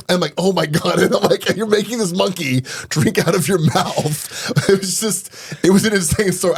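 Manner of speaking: high-pitched, laughing through the words; barely holding back real laughter; laughing while talking. Real, not acted. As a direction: tell it while cracking up. Cracks up telling monkey story.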